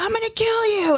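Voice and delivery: put-on high, squealing kid rage; raging little brother. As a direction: shriek like an angry kid brother. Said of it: "then he's like".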